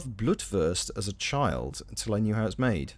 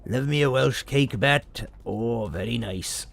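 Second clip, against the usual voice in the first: mock Welsh accent. Praising Welsh cakes in mock voice.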